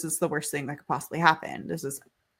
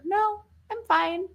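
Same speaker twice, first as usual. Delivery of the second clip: put-on small child voice; 13 semitones higher, slower than usual. Daughter quoted.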